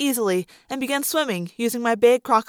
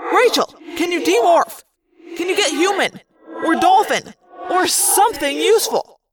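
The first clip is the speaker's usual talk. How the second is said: higher-pitched, pressing questions; urgent questioning; 5 semitones higher than usual. A narrator's reading. Jake pressing Rachel to demorph, urgent. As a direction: urge her on, exasperated.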